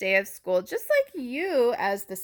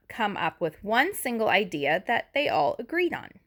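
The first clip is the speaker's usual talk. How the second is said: plain reading voice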